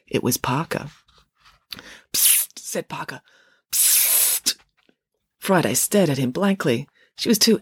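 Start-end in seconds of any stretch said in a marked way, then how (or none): 2.1-2.6 voiceless attention-getting hiss; hissed psst. Acted. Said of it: "sound like a leaking bicycle tyre". 3.7-4.6 long voiceless hiss for attention; hissed psst. Acted. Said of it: "sound like a leaking bicycle tyre".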